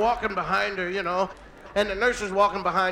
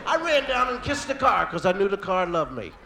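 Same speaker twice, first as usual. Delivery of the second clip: usual speaking voice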